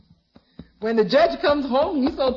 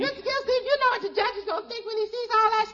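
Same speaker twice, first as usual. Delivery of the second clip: much higher, giggling through the punchline; giggly; 9 semitones higher than usual. Acted. Maid giggling as she retells her wrong house joke to the boss. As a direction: bubble over with her own joke.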